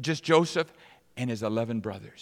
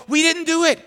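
frightened protest; 9 dB louder than usual. Acted. Brothers protest innocence.

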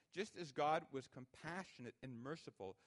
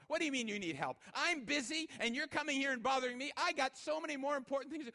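much higher, loud irritated clerk; annoyed clerk; 11 semitones higher, 9 dB louder than usual. Retold or imitated. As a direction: rant like a busy employee brushed off. Rude clerk voiced in story.

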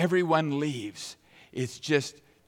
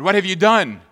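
a raised, accusing question to the brothers; stern accusation; 6 semitones higher, 10 dB louder than usual. Acted. Joseph accusing his brothers, raised voice. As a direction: confront them with what they did.

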